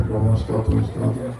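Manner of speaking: weak, fading voice of a starving man; faint feeble praise. Retold or imitated. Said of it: "ఆ sound రావట్లేదు ఇంకా". (బలహీనమైన నీరసమైన గొంతు)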